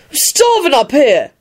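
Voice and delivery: slow, high petulant moan; high-pitched hungry sulk; sulky. A narrator's reading. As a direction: whine it out, drawn out and high. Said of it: "Ron sulked".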